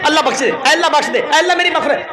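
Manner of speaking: fast, tossed-off mock begging with a laugh; hurried mock prayer. Preacher mocks hasty begging for forgiveness, fast and laughing. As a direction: rattle it off half-heartedly. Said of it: "आप ऐसे कहेंगे".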